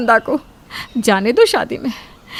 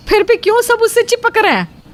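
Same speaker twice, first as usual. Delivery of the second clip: high, jealous disbelief; can't believe they still flock to her; baffled; 10 semitones higher than usual. Acted. Usha muttering jealously to herself, much higher baffled.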